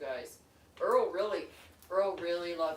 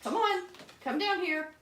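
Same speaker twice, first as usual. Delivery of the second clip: much higher sing-song coaxing; high pet voice; 13 semitones higher than usual. Real, not acted.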